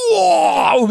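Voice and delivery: high, loud startled wow; startled exclamation. Real, not acted. Teller reacting to the thief vomiting, loud startled wow. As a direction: jolt back at the sudden mess.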